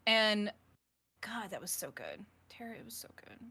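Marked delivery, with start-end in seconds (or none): 1.2-3.4 breathless whispered praise. Real, not acted. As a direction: whisper it, overwhelmed.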